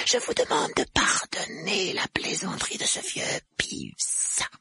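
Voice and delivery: slow, whispery, fawning creature; cringing spook apologising to his master; grovelling poltergeist. A narrator's reading. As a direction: beg pardon obsequiously in a drawn-out rasp.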